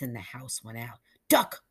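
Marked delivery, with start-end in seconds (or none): 1.3-1.6 high, louder warning; urgent cry. A narrator's reading. Hank warning his friend to hide, urgent cry. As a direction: cry out to make him drop. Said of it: "cried Hank".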